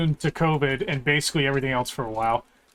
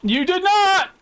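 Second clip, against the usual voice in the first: teasing high singsong; 11 semitones higher, 4 dB louder than usual. Real, not acted. Teasing teammate.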